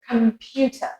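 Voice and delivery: British Computer ending in "tuh"; British accent. Shown as a demonstration. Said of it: "with the American accent".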